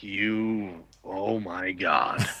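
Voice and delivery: low, soft, slow disbelief; stunned realisation. Retold or imitated. Diver realising his friend really knows the spot, stunned. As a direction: let it sink in, hushed and drawn out.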